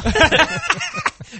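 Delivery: high-pitched burst of laughter. Real, not acted. Laughing fit on air.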